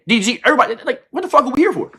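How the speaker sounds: normal talking voice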